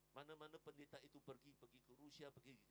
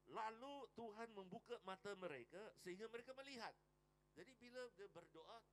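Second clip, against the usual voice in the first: usual speaking voice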